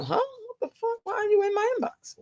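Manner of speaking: high, shocked squeak of disbelief. Retold or imitated. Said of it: "that was my reaction".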